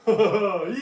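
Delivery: evil laugh. Pretend. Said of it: "what does a mad silent scientist sound like".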